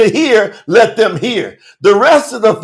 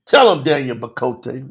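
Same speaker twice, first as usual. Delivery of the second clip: ordinary talk, nothing marked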